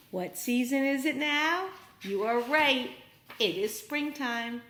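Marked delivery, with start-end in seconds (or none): none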